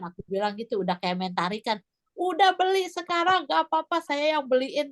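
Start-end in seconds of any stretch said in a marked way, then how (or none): 2.2-4.9 higher-pitched imitation of urging sibling; insistent older sibling. Sibling urging her to buy the test, insistent retold voice. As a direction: push them to go along, pitched high. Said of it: "katanya gitu".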